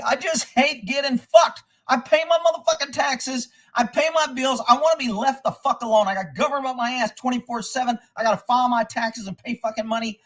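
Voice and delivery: worked-up, high-pitched venting; furious rant. Real, not acted. Taxpayer venting about government on his back, furious. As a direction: let the grievances pile up, pitched high. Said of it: "I was freaking furious".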